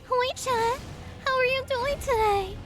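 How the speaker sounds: put-on sweet high anime girl greeting; uwu VTuber voice. Shown as a demonstration. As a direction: coo it like a cute streamer. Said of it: "and you say".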